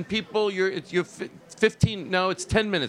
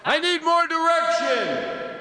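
high, drawn-out mock yell; hammed-up shouted demand, very high and slow; demanding actor's shout; 12 semitones higher, slower than usual. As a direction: wail it like a diva actor. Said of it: "an actor asking for more direction".

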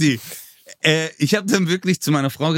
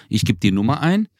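ordinary talk, nothing marked

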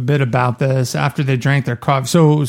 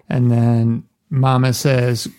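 ordinary delivery

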